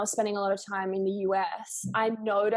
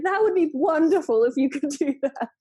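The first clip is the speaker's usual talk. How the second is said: higher and much louder, gushing relief; relieved delight; 7 semitones higher, 8 dB louder than usual. Retold or imitated. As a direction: burst out with happy relief. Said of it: "I was like".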